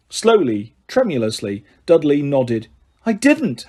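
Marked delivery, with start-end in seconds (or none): 3.0-3.7 sharp. Acted. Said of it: "Harry said sharply".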